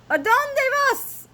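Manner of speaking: very high, loud kitten call. A narrator's reading. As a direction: shout the Spanish question in a high, stretched voice. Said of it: "called out Skipito".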